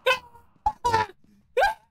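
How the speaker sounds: frightened screams. Real, not acted. Sees a ghost, screams.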